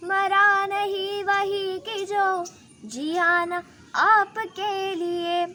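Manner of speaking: slow, loud, ringing declamation; forceful poem recital. Acted. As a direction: declaim every line like a battle cry.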